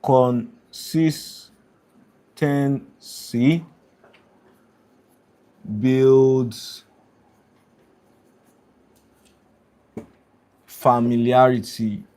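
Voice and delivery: very slow, drawn out while writing; slow dictation. Real, not acted. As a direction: spell it out slowly as if writing. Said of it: "how I'm, like, writing the points down".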